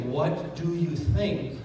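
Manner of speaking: drawn-out question, slowed right down; slow pitch question. Shown as a demonstration. Seller slowly asking the client, drawn-out. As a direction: stretch out what do you think. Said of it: "the minute you say".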